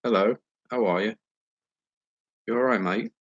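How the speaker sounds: put-on playful English accent, chummy London greeting. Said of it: "Let me try to be English".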